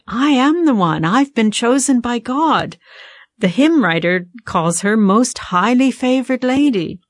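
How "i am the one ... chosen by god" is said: higher-pitched, puffed-up boast; mock pride. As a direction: play someone puffed up with pride. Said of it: "puffed up with pride".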